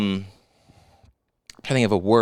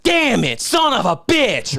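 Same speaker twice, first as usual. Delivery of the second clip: high, slow mock curse; hammed-up frustration over a broken bong; mock outburst; 10 semitones higher, much slower than usual. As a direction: drag out the swear like a fake tantrum. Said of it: "you know, was like".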